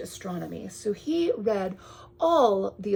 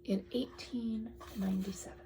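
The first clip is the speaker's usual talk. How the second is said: whisper; 8 dB quieter than usual. Real, not acted. Whispered reading detail.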